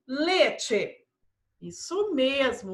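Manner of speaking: ordinary talk, nothing marked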